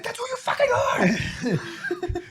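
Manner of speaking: very high, squeaky retort; mock insistent. Uncle arguing with niece, high mock insistence. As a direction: insist in a high put-on cry. Acts out teasing reply to niece.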